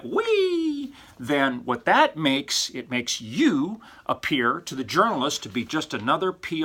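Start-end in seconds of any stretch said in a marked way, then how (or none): 0.0-0.9 a high, silly little mock whee; mock squeal of glee. Lecturer mocks a hype-filled release with a mock squeal. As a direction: toss off a giddy fake cheer. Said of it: "if you make 'em sound like".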